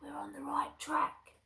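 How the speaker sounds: high whispering crook; hushed storybook thief; sneaking thief. Acted. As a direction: whisper it like a creeping burglar. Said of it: "said Hugh".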